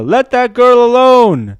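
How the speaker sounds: loud, high, drawn-out yell; theatrical heroic holler; acted shout. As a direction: shout it like calling from a window. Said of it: "He shouts from his window".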